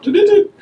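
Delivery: high-pitched imitated alarm beeps; beeping car alarm noise; alarm imitation.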